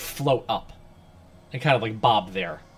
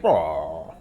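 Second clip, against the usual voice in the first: mock beast roar; 9 semitones lower than usual. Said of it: "Anpu's like".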